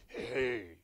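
put-on a sudden deep man's voice from a young girl; deep male voice. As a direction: drop into a demonic male voice for one word. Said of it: "with this deep male voice talking".

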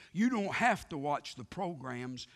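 normal talking voice

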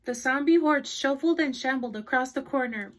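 plain reading voice

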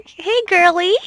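high, loud happy call to the horse; excited greeting. Real, not acted. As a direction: call out to your new mare with joy. Greeting her new horse.

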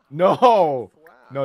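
loud shout of no. Real, not acted.

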